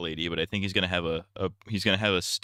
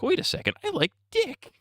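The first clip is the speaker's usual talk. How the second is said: much higher squeaky surprised realization; mock Sokka. Mocking Sokka realizing a secret in a high voice. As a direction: pitch way up as a dumb Sokka. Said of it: "Sokka's like".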